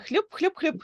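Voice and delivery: high playful splash noises; splashing sound. Shown as a demonstration. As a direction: mimic a frog splashing. Teacher imitates frog splash sound.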